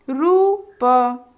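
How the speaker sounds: very slow syllable drill; teacher building words slowly; slow sounding out. Shown as a demonstration. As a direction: stretch each part for children. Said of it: "उच्चारित करने के लिए कहेंगे".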